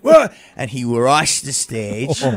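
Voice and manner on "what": outraged what. Retold or imitated. Heckler's outraged reaction.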